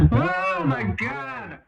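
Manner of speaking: very high, slow squeal of disbelief; squealing through leftover laughter; amused disbelief. Real, not acted. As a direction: stretch it out, still laughing. Interviewer reacting while laughing.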